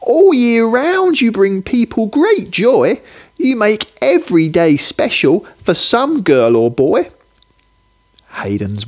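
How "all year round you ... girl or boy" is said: put-on little boy. Hayden telling Johnny why he is special, high and earnest. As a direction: tell him like an awed small boy. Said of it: "Hayden tells Johnny".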